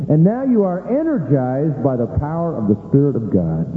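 ordinary talk

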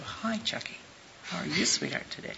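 soft, hushed tender hello; soft gentle greeting. Retold or imitated. Softly greeting the sick boy Chucky at his bedside. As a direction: speak gently to a sick child. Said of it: "No, you go".

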